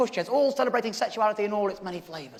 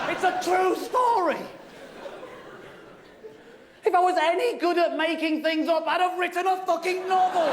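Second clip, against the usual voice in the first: exasperated, raised-voice outburst; indignant shout; 5 semitones higher than usual. Acted. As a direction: insist on it at a yell. Indignant insistence the story is true.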